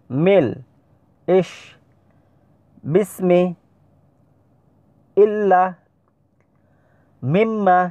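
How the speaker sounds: deliberate Arabic recitation drill, syllable by syllable; Arabic letter drill. Shown as a demonstration. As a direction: call out every syllable crisply like a Qur'an teacher. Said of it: "আমি আবার পড়ছি, লক্ষ্য করে শুনুন।".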